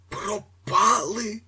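very high, slow squeak. A narrator's reading. Vorobyaninov squeaking in dismay at lost chairs. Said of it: "пискнув Вороб'янінов".

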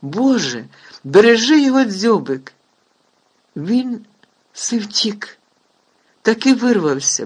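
loud, high tearful relief; tearful joy. A narrator's reading. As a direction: cry out in overwhelming relief. Hagrid overjoyed tearful.

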